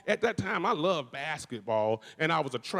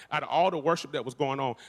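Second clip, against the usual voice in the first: usual speaking voice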